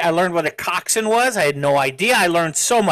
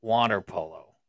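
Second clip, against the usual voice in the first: low, slow, creepy drawl; creepy man voice; 8 semitones lower, 6 dB quieter than usual. Acted. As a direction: purr it out like a creepy guy. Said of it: "why are you saying it like a creepy man right now".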